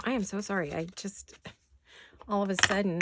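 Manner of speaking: normal talking voice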